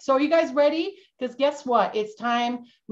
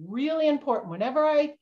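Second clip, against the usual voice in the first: ordinary delivery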